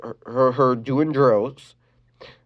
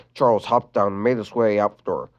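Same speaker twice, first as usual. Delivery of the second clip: usual speaking voice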